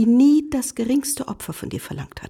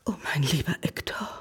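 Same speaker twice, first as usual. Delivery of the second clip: low, soft unvoiced whisper; whispered greeting to her husband; tender whisper; 8 semitones lower, 7 dB quieter than usual. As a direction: breathe his name lovingly. Wife greets lost husband.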